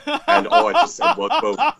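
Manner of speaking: high giggling lead-in to a retort; laughing. Real, not acted. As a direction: laugh it out in disbelief. Laughing at an offensive comment.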